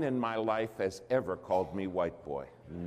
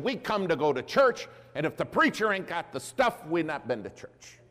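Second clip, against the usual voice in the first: higher and louder, emphatic; preaching church lady; 6 semitones higher, 4 dB louder than usual. Retold or imitated. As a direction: drive home the rule like the church lady. Lady's church rule retold.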